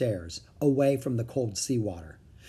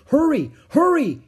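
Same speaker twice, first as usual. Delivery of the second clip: high, loud urging; urgent hurrying; 13 semitones higher, 10 dB louder than usual. A narrator's reading. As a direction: rush them along with a shout. Man rushes children, time running out.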